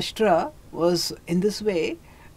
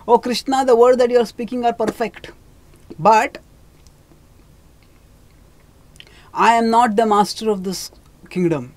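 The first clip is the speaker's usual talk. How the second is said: put-on higher, louder voice of an old king; a blind king excusing himself, voice raised; pleading old king; 4 semitones higher, 5 dB louder than usual. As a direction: protest your helplessness to Krishna, raised and earnest. Dhritarashtra answers Krishna.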